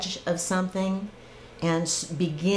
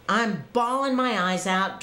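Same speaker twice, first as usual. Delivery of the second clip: ordinary talk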